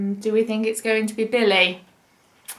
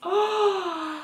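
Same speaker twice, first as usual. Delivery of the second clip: high, soft excited gasp; sharp gasp; 7 semitones higher, 5 dB quieter than usual. Acted. Reading to a child, dog catches a scent, gasps. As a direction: gasp in delight.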